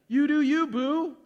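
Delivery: high, loud mock-sassy voice; mocking sassy slogan. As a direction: toss it off like a sassy catchphrase. Mocking slogan, repeated louder.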